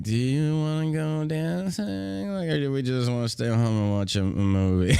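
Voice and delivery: slow, drawn-out mock question; mock bored couple. To his partner, a slow, mock lazy question. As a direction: lazily weigh dancing against a movie.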